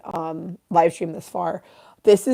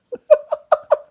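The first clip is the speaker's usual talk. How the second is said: loud, very high burst of laughter; 6 dB louder than usual. Real, not acted. Streamer cracking up at own silly question, high laugh.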